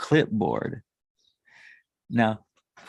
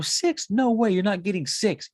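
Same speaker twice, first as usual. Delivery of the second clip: higher, astonished dad refusing; mock disbelief; 6 semitones higher than usual. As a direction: jump up in pitch at the outrageous number. Dad refusing son's big ask.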